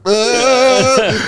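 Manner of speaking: long, very high wail; mock distressed wail. Tripping fan hearing a dead-dad song, mock high wail. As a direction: wail in comic overwhelm.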